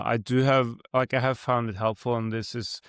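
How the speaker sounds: ordinary delivery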